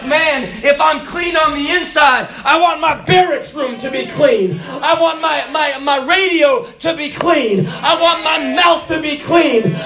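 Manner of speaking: high, driving shouted sermon; impassioned preaching. Acted. Preacher proclaims God's word to the congregation, impassioned shouted preaching. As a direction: preach at full cry, stretching the key words.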